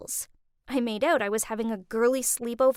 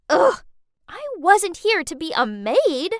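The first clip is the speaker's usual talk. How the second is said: high, loud frustrated ugh; annoyed outburst; 6 semitones higher, 6 dB louder than usual. Acted. Teen fuming at being told to babysit, loud annoyed protest. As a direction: groan and protest like a put-upon teen.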